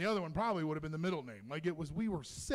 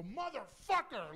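stretched, high-pitched mock yell; mock labor outburst; 7 semitones higher, 3 dB quieter, slower than usual. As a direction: imitate a woman cursing through labor from afar. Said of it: "her in the background go".

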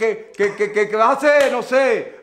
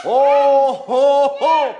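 booming ho-ho-ho, then festive welcome; Santa Claus. Acted. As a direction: welcome the children like a showman Santa. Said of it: "Porque yo soy Santa". (Papá Noel animador)

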